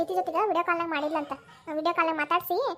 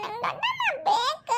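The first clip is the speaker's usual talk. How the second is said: very high, slow whimper; whiny child's plea; 9 semitones higher, slower than usual. Acted. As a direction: stretch it out, begging for auntie. Child demands his aunt, fretting.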